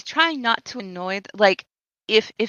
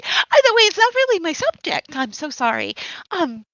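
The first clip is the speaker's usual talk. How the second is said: Lady Blossom voice; 4 semitones higher, 6 dB louder than usual. Acted. In-character reply as Lady B.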